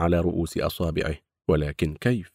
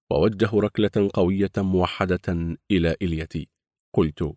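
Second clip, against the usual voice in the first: ordinary delivery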